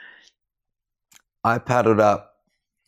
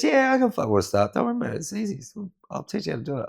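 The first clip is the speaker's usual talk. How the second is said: high, squeaky imitation of the old surfer, breezy and offhand; mock old guy voice; 11 semitones higher, much faster than usual. Older surfer telling a struggling learner it's easy, squeaky mock voice. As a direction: ham up the old man's easy confidence, speeding up at the end. Mate Mick brushing off worries.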